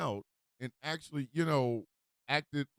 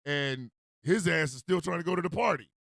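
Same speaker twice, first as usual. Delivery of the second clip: usual speaking voice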